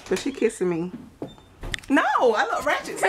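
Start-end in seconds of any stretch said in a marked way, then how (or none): 1.9-3.1 laughing protest. Real, not acted. Laughing refusal.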